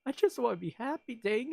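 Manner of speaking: playful whine of being an emotional wreck; hammed-up self-pity; mock despair. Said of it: "I was like".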